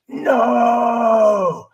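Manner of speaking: mock dragon shout from the anger monster; booming dragon voice. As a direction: bellow it like a dragon behind a door. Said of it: "big, booming, dragon-like voice".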